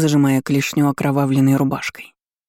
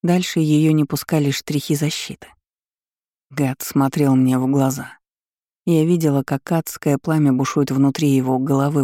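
ordinary delivery, nothing marked

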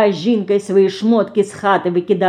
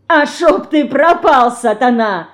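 laughing curse; 5 semitones higher, 4 dB louder than usual. A narrator's reading. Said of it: "хохоча и вытираясь рукавом".

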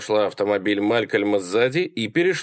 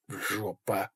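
very soft, hushed dismay; quiet, resigned thought to oneself; grim inner thought; 11 dB quieter than usual. A narrator's reading. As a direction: say it under your breath. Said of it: "подумал он".

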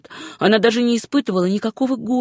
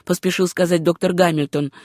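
plain reading voice

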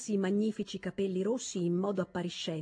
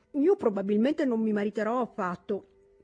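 toothpaste in mouth; 3 semitones higher, 6 dB louder than usual. Acted. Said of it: "con una certa fatica perché la polvere del dentifricio".

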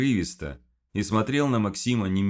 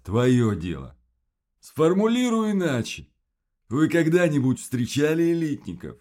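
higher, louder creature voice; monster voice; 8 semitones higher, 6 dB louder than usual. Acted. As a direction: voice the monster pressing for answers.

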